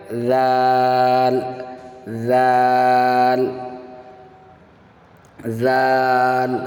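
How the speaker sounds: زال held long on a level note; slow model recitation of زال; drawn-out letter name. Shown as a demonstration. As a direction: sustain the vowel of زال steadily. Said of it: "تو پروناؤنس اس کا دیکھا".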